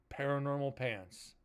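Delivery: usual speaking voice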